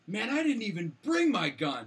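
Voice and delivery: high, loud imitation of a griping stranger; mock indignant exclamation. Overheard stranger complains to his friend, mock indignant. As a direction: call it out in mock frustration.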